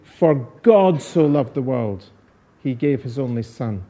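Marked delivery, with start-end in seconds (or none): 0.0-2.0 GOD drawn out and weighted in "For God so loved"; stress on GOD. Shown as a demonstration. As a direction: lean slowly on the word God. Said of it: "putting the stress on different words".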